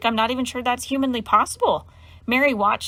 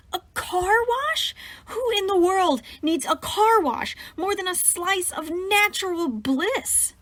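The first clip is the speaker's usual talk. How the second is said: much higher, slow, aghast cry; horrified; 9 semitones higher, slower than usual. Acted. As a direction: disbelief rising to panic. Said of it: "Mary looked horrified".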